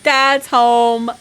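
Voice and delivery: loud, sustained announcement; calling out. Pretend. Gamer announcing that Dad's home, playfully calling out. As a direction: hold the last word long and loud. Repeats it as drawn-out call.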